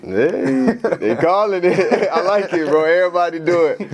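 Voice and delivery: real amused laugh through the words. Real, not acted. Host laughs at guest's joke.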